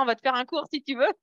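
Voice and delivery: normal talking voice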